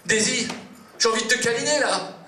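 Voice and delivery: eager high declaration; blurted confession. Acted. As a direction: spill it out all at once.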